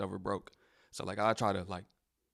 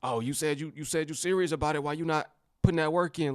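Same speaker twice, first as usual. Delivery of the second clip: higher, pressing a friend to work; pushy mentor; 7 semitones higher, 3 dB louder than usual. Retold or imitated. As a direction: nag them about their hustle. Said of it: "But I would try to, like".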